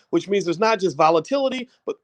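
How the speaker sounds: usual speaking voice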